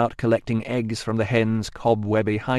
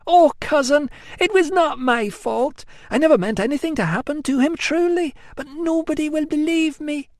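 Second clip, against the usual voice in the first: put-on high, plaintive young woman's wail; whiny Amelia. A narrator's reading. Amelia wailing to her cousin, high and plaintive. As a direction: wail it like a spoilt cousin begging pity.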